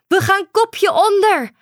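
high, loud warning call. A narrator's reading. Girl warning the turtle before diving, calling out loud. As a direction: shout it over the crashing waves. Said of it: "riep ze uit".

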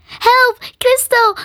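high, loud panicked call; cry for help. A narrator's reading. Ellen to Crystal as giant spider nears, crying out. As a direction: call out desperately at high pitch. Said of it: "riep Ellen".

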